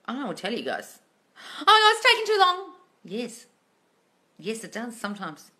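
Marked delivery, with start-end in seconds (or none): 1.4-2.7 high, loud mock wail; hammed-up impatient complaint, pitched way up; mock whining. Mimics viewers' impatient complaint.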